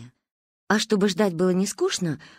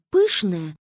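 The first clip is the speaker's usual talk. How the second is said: plain reading voice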